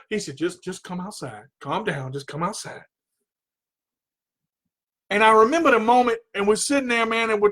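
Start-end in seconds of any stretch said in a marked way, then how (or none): 0.4-2.8 much softer and lower; calming coach. Retold or imitated. Coach taking an upset student out of the dojo, calming. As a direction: talk him down quietly.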